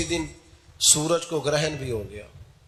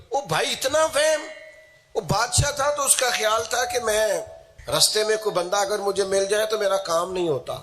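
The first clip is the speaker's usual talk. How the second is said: high incredulous outburst easing into raised storytelling; exclamatory preaching; 7 semitones higher than usual. Real, not acted. Preacher decrying a king's superstition, high exclamatory delivery. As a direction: exclaim it high, then settle gradually.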